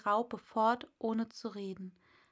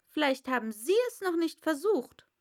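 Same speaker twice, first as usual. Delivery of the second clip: put-on high, louder, fast girl; an eager young girl; Alice; 6 semitones higher, 6 dB louder, faster than usual. Alice voiced. (schnelles, hohes Mädchen)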